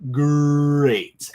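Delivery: loud drawn-out sneering great; mock MJF, sneer the word; MJF-style great. Mimicking MJF to wrestling fans, loud sneer. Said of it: "as M J F would say".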